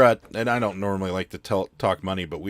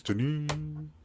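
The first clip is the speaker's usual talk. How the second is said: mock app chime, higher; imitating notification chime; 7 semitones higher, 4 dB quieter than usual. Seller imitating the late eBay chime, higher. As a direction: sing the ding like a phone alert. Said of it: "the one that goes".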